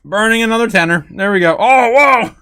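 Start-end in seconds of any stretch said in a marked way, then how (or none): 1.6-2.4 startled. Real, not acted. Fire flares up on desk.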